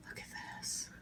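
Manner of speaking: "Look at this" breathed out with no voice at all; excited whisper. Real, not acted. Cook showing her plate to viewers, whispering in excitement. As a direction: whisper "Look at this" as if it were a secret. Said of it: "I don't know why I'm whispering".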